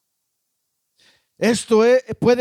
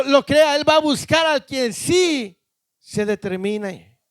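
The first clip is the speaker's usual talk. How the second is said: forceful preaching; 5 semitones higher than usual. Real, not acted. Preacher warning the congregation God will look elsewhere, forceful preaching. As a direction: preach at full force, raised and relentless.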